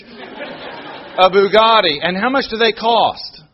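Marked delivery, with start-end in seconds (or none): 0.0-2.0 Bugatti drawn out slowly with a hammed-up Italian accent, "buh-gah-dee"; mock Italian. Shown as a demonstration. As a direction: say Bugatti like an Italian meatball sandwich, in a comic Italian accent. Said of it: "it sounded like an Italian meatball sandwich".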